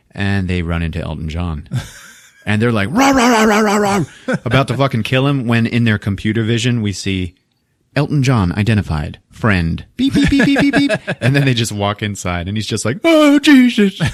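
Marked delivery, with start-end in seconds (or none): 2.9-4.0 high, loud mock growling rahs; robot dog snarls. Robot dogs lunging at [name], loud mock snarling. 10.0-10.9 very high, louder mock beeping; computer beeps. Robot dogs' vision identifies a friend, high mock beeping. 13.0-14.0 startled gasp. Retold or imitated. [name] right after the dogs spare him, high loud shock.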